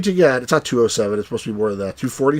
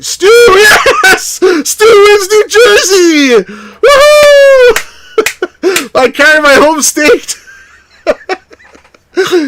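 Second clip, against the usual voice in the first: shrieking with joy, much higher and louder; ecstatic cheering; 8 dB louder, much slower than usual. Real, not acted. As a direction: scream it like you just won the state. Wins his home state in game.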